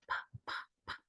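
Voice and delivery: "pa, pa, pa" as unvoiced puffs; voiceless P. Shown as a demonstration. Teacher showing P is not voiced, whispered puffs. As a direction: pop "pa, pa, pa" with breath only. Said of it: "no es sonoro".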